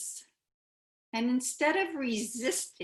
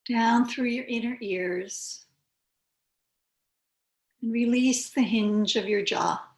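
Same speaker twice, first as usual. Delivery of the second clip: unhurried yoga teacher, long pauses between phrases; calm, spacious meditation voice; guided meditation. Real, not acted. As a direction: guide them gently into stillness, phrase by phrase.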